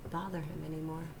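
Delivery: plain reading voice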